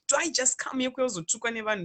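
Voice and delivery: ordinary talk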